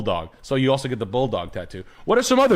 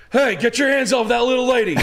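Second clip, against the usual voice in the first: high, hammed-up heroic call; 11 semitones higher than usual. Defending a lady from his truck, mock-heroic shout.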